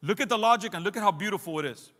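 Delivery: normal talking voice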